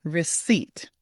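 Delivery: "Receipt" with no p sound, stress on the second syllable; silent P. Shown as a demonstration. Coach practising together with students, silent P. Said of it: "let's go ahead and try it together".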